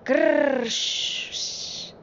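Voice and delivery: loud rolled growl into hushing hiss; imitated crash. A narrator's reading. Train hits the rain clouds, rolled crash noise. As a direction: growl hard, then let it fizzle in shushes. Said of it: "the train crashed".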